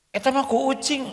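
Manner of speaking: a hint of a husband dodging blame; husband's excuse. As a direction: brush it off, it was the cat.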